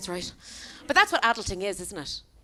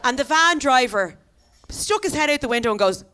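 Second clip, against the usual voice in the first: normal talking voice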